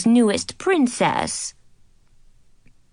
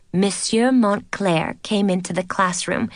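plain reading voice

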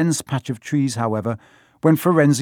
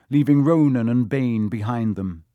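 usual speaking voice

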